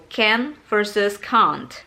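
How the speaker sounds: short "can", then "can't" as "kahnt"; British can/can't. Shown as a demonstration. Teacher shows the British difference between can and can't. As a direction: slowly set "can" against a long-ah "can't". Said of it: "Jadi ada bedanya gitu".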